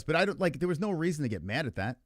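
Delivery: normal talking voice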